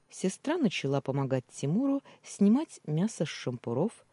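plain reading voice

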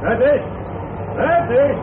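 high, loud greeting; calling out. Acted. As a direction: call across to him. (luid en hoog roepend)

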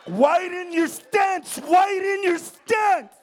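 slow, loud coaching yell; shouted coaching. Retold or imitated. Comic yelling advice at the falling president, slow shout. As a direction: bark instructions slowly like a coach.

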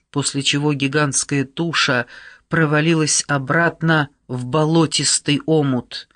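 ordinary delivery, nothing marked

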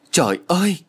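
high despairing wail to the sky. Acted. Woman finding no trace of the thief, high despairing wail. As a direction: cry out in despair.

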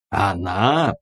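astonished short question; mildly incredulous query; surprised chorus. A narrator's reading. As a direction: ask it in disbelief. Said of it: "хором спросили Леший с Чинуком".

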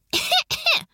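pointed fake-sounding cough; high, short double cough; loud cough. A narrator's reading. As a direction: cough to interrupt. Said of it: "Громко закашлялась Элайна".

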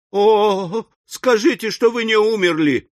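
high-pitched, overwrought entreaty; hysterical pleading. A narrator's reading. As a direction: implore him in hysterics. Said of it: "восклицала истерическая леди".

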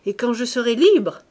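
libre lancé haut et fort, qui retombe; exalté. Acted. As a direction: crier son rêve de liberté. (exalté, libre fort)

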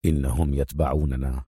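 low, soft undertone; hushed. A narrator's reading. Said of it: "أجابه أدهم في همس".

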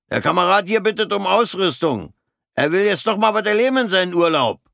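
high, loud gloating jeer; gleefully mocking. Corporal mocks Fritz to the sergeant, high and gloating. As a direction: crow over your own joke.

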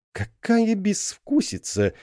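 slow, high, outraged exclamation; indignant. A narrator's reading. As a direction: sound appalled at the bad taste. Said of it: "донёсся возмущённый голос тёмного эльфа".

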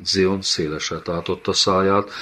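ordinary delivery, nothing marked

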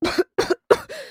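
acted bout of coughing; rolling cough. A narrator's reading. Sick girl still in her fit, coughing. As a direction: cough repeatedly, high-pitched. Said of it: "Зашлась раскатистым кашлем девчушка".

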